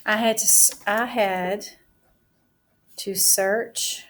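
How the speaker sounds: drawn out, word by word as she writes; teacher dictating her sentence while writing; slow dictation. Shown as a demonstration. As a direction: say it at writing speed for the class.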